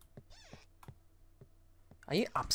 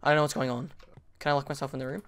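ordinary delivery

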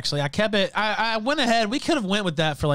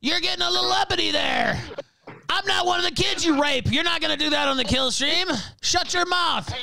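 furious shout; 11 semitones higher than usual. Real, not acted. Host shouting down a heckler in the chat, furious. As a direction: bark him down, full of contempt.

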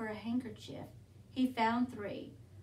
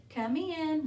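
higher, louder, slightly grudging invitation; less friendly welcome; 5 semitones higher, 4 dB louder than usual. A narrator's reading. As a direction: invite them in, a touch less friendly than intended. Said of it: "sounding a bit less friendly than he intended".

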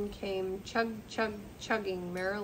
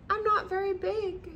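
much higher, little voice; small engine voice; 12 semitones higher, 4 dB louder than usual. A narrator's reading. Little blue engine telling the toys she is small, high voice. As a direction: sound small and modest. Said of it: "said the little blue engine".

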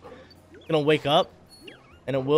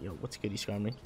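much softer, lower hushed hello; quiet, low aside to the Pokemon; hushed greeting; 6 semitones lower, 12 dB quieter than usual. Real, not acted. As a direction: murmur a hello so you don't scare it off.

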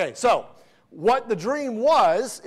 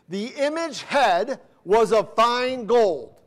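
scripture reading; 4 semitones higher, 4 dB louder, much slower than usual. Acted. Reads scripture verse.

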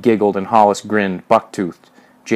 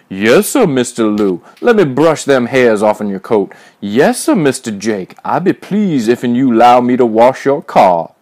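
exaggerated, loud, fawning servant voice; old-time servant imitation; 3 semitones higher, 5 dB louder than usual. A narrator's reading. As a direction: grovel loudly in a mocking put-on voice. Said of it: "ugly imitation of an old-time Negro servant".

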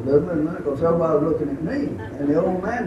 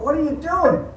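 higher calling voice; wife calling from the motorhome, voice raised high; called out; 11 semitones higher than usual. Retold or imitated. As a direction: call across the parking lot, pitch up.

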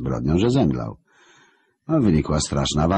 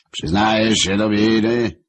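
higher, louder, slow booming question; thundering question; 7 semitones higher, 5 dB louder, slower than usual. Acted. Lucifer to the trembling devil Marek, booming slow. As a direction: thunder it across hell. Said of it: "zagrzmiało po piekle".

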